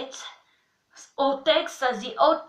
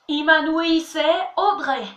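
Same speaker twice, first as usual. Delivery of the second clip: ordinary talk, nothing marked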